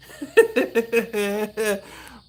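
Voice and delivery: laughing after the bit; real laughter. Real, not acted. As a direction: crack up again. Laughing at own impression.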